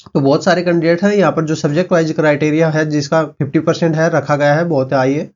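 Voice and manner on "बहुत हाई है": usual speaking voice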